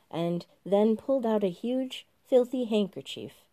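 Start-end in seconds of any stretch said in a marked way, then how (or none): none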